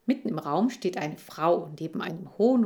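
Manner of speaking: ordinary delivery, nothing marked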